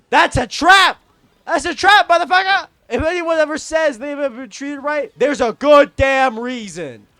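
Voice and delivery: high, very loud outburst; outraged full-voice rant; shouted warning. Real, not acted. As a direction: yell it like an alarm. Warning against a trap in outrage.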